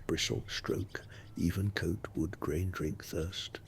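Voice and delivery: soft breathy ASMR whisper. Acted. Soft ASMR run, partly unvoiced.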